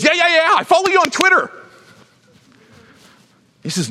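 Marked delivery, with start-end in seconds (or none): 0.0-1.5 high, eager and casual; high flippant yes-man; breezy brush-off. Retold or imitated. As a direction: toss it off like a shallow fan. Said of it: "He goes".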